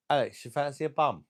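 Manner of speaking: high and loud, teasing proposition aimed at Alex; loud cheeky offer. Acted. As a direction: call it out cheekily to the chat. Repeats offer to viewer Alex.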